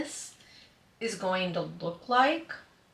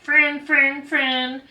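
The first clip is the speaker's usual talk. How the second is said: high, loud chanted repeats; puppy excitement; 6 semitones higher, 7 dB louder, much slower than usual. Retold or imitated. Puppy jumping on a newcomer, loud excited yaps. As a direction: yap it like an eager puppy. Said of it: "licking their face and being like".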